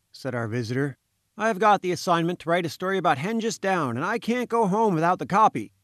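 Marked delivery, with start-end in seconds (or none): 1.4-5.7 high-pitched insistent reporter; pleading journalist. A narrator's reading. Journalist pleading with Malone for a story, high and insistent. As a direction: press the case in a raised, urgent voice.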